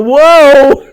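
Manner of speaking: loud laughing startled cry; a laughing yelp of surprise; startled whoa. Retold or imitated. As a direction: blurt it out, half laughing. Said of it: "would have heard me go".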